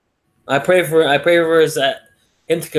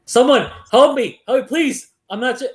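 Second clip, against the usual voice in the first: high panicked cry for help, pitched up; retold panicked scream; 10 semitones higher than usual. Man in chimp suit fallen into the lion cage, panicked high yell. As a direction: yelp for help like you're about to be eaten. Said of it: "He starts screaming".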